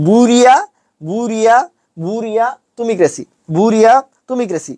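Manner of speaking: বুড়িয়া drawn out as "buu-ria", with pauses; broken up for spelling. Shown as a demonstration. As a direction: slowly separate বুরিয়া / তুমি ক্রেসি. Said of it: "একটু ভাঙাইয়া পড়বা".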